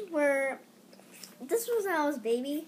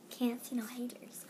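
breathy whispered aside; hushed joking whisper; 2 semitones lower, 9 dB quieter than usual. Real, not acted. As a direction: drop to a whisper for the joke. Whispered joke.

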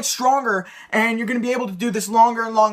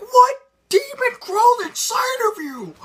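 much higher squeaky alarmed friend; freaked-out friend. Retold or imitated. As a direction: squeal it like a spooked buddy. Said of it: "they're gonna be like".

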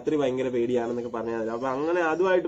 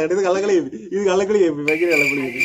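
loud accusing; 3 semitones higher, 6 dB louder than usual. Real, not acted. Accusing her of cheating.

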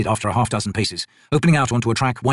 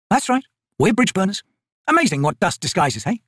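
higher, brisk soldier woman's voice; Picker, tough soldier; 9 semitones higher than usual. Acted. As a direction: voice the corporal, dry and sure of herself. Picker's voice in dialogue.

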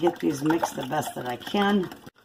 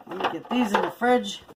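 ordinary talk

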